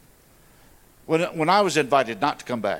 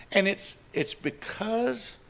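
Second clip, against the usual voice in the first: getting emotional; 6 semitones higher, much slower than usual. Real, not acted. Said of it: "I'm gonna get all emotional here".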